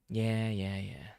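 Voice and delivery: ordinary talk, nothing marked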